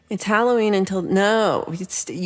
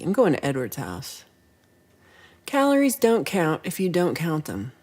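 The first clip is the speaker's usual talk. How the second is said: ordinary talk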